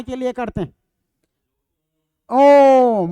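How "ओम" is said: ओम called out loud and long. Shown as a demonstration. Teacher showing students a pluta call, loud and drawn-out. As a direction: call ओम out like summoning someone. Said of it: "अब आप लोग बोलोगे जोर से चिल्ला कर".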